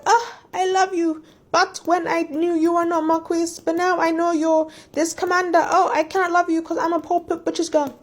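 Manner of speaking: high-pitched mock whiny lovesick lament; whiny heroine. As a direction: whine it melodramatically, sending up an annoying heroine. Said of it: "whiny and annoying. She's like".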